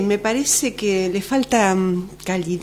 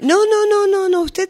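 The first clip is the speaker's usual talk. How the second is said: much higher, hurried no no no; nervous doctor pretending all is fine; flustered denial; 12 semitones higher, 4 dB louder than usual. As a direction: cover up the alarm, too high. Doctor hides bad news nervously.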